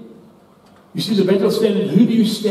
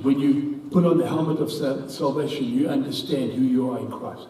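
normal talking voice

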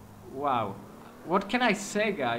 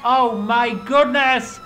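loud, slow, higher gasp of amazement; stunned by the crash in front of him; astonished; 5 semitones higher, 9 dB louder, slower than usual. Real, not acted. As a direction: stretch it out in shock. Huge crash during chase.

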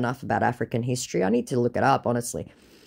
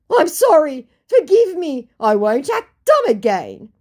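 high, loud begging; mock pleading apology; 12 semitones higher, 8 dB louder than usual. Scammer begging her forgiveness, mock pleading. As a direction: grovel in hammed-up desperation.

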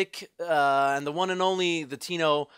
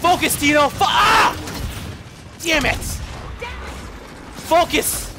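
high, loud angry shout; furious yelling; 10 semitones higher, 4 dB louder than usual. Real, not acted. Gamer losing the round, yelling in real fury. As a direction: scream at yourself. Raging at game.

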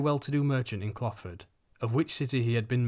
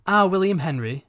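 loud, higher, emphatic "Our William Henry"; with heat and emphasis; 7 semitones higher, 8 dB louder than usual. A narrator's reading. Wife defending son to Matthew, heated emphasis. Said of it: "with heat and emphasis".

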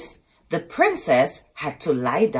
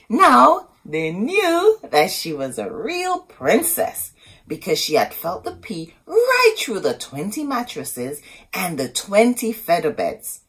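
ordinary delivery

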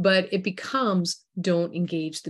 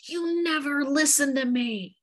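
high, slow mock outburst; enraged; 9 semitones higher, slower than usual. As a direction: act out an enraged accusation, slowly. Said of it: "And they're enraged".

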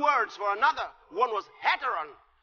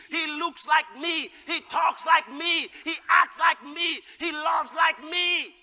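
loud, high preaching build; full-force sermon climax; fiery preaching; 4 semitones higher, 4 dB louder than usual. Acted. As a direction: preach it out with rising fire.

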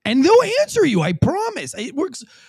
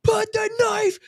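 high, slow shouted command; mock cop shout; 13 semitones higher, much slower than usual. Mocking cops who scream at the knife man. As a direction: ham up a cop screaming orders.

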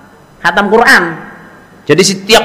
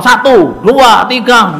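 knockout count; 6 semitones higher, 4 dB louder, much slower than usual. Retold or imitated. Mimics knockout count after a fall.

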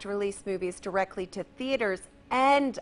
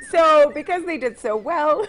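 anchor stifling giggles; 4 semitones higher, 5 dB louder than usual. Real, not acted. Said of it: "keep it together".

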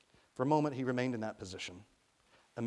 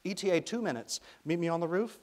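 high, loud radio call; young woman's raised voice on a radio; shouted radio update; 7 semitones higher, 6 dB louder than usual. A narrator's reading. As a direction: call out instructions over wind. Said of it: "She rattled off a string of data".

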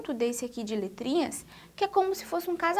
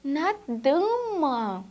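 1 slow answer; much slower than usual. Shown as a demonstration. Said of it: "Onde é que nós podemos encontrar a sílaba dá?".

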